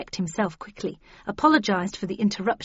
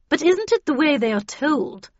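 higher-pitched, insistent challenge; not-to-be-argued-with; 7 semitones higher, 4 dB louder than usual. A narrator's reading. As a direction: put the question so nobody dares argue. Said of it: "in her not-to-be-argued-with voice".

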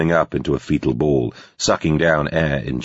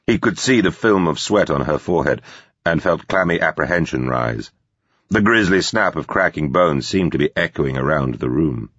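ordinary talk

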